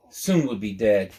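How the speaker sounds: ordinary delivery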